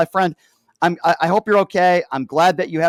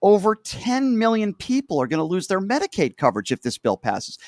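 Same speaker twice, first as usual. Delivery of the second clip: ordinary talk, nothing marked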